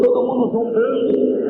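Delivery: ordinary talk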